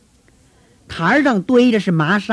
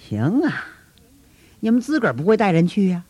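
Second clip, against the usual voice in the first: ordinary delivery, nothing marked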